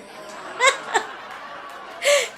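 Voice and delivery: high breathy laugh. Real, not acted. Laughing at own story.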